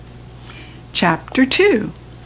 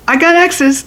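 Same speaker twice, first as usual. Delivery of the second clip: hollering; 8 semitones higher than usual. Acted. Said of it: "I hollered".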